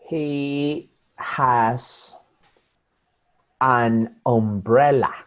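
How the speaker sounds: "He has an umbrella" very slow, every word apart; deliberate, unlinked "he... has... an umbrella"; careful separated words. Shown as a demonstration. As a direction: leave gaps between the words of "He has an umbrella". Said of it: "That's well pronounced".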